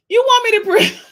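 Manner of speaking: high, loud incredulous squeal; shocked squeal. Real, not acted. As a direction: squeal in disbelief. Shrieking surprised question.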